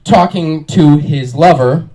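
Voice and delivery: ordinary talk, nothing marked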